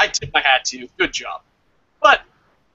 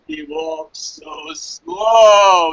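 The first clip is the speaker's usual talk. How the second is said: drawn out slowly; 3 semitones lower, much slower than usual. Real, not acted. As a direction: stretch every word out slowly. Mocking Orton's slow entrance.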